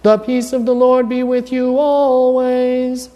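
liturgical intoning. Shown as a demonstration. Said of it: "Here's the first one".

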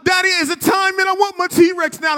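put-on high, insistent child's demand; impatient child. Son demanding his T-Rex now, impatient child. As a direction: push like a boy who can't wait. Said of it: "He said".

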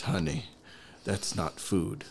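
slow, slightly soft imitated grandmother; gentle grandmotherly admonishment. Grandmother pats guest's face, gently admonishing. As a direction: break it to them slowly and tenderly.

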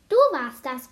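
loud accusing shout; calling out. A narrator's reading. Girl accusing the book thief, calling out. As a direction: burst out loudly with the accusation. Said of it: "rief Emily".

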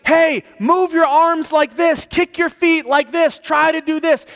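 urgent high shout, loud and insistent; shouting instructions. Retold or imitated. Prophet on shore shouts to drowning man, high and shouting. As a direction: call out swimming instructions at the top. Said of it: "he was shouting out to me".

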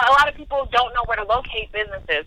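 ordinary talk

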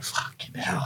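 breathless whispered curse; whispered swear. Retold or imitated. To himself after meeting a wrestler, a stunned whisper.

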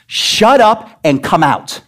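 emphatic preacher's command; giving orders. Shown as a demonstration. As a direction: order the demon out, word by word. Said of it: "giving orders".

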